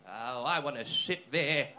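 put-on low, slow, loud old-guy voice; old man impression. Comedian voicing old guy wanting the seat, slow old-man impression. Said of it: "old guy came over and he just went".

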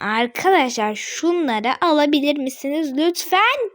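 ordinary talk, nothing marked

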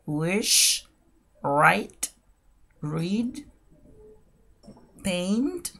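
isolated words in a rising list; slow demonstration. Shown as a demonstration. Teacher giving learners a verb list, slow word-by-word delivery. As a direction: pronounce one word, pause, then the next. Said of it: "lo voy a hacer de manera lenta y despacio".

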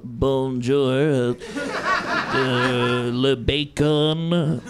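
hesitant, drawn-out beginner's French; mock seized-up fear, stretching out each word; halting, fearful French. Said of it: "this fear that, like, seizes me".

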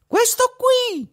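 put-on tiny high-pitched boy's voice, slow. A narrator's reading. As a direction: speak like a tiny boy answering from inside a horse. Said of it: "rispose una vocina". (vocina acuta e minuta)

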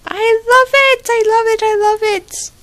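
high, loud squeals that settle down; thrilled squeal. Real, not acted. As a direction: squeal with delight, then ease off. Thrilled unboxing gift.

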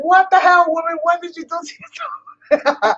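high-pitched exaggerated disbelief; hammed-up astonished outcry; mock shock. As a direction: imitate an appalled man, voice shooting up. Imitating shocked man at the store.

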